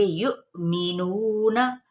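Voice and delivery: युमिनूना slow, with a catch on यु; slow tajweed recitation; glottal catch. Shown as a demonstration. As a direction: give a sharp stop in यु and stretch नू. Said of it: "हम झटका देंगे".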